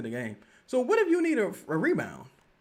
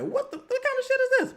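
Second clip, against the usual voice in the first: mock disbelief. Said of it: "I be like".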